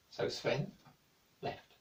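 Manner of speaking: ordinary delivery, nothing marked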